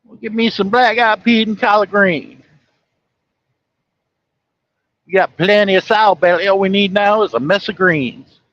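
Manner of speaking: exaggerated high Southern twang. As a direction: put on a sloppy Southern country voice. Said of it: "with a southern twang".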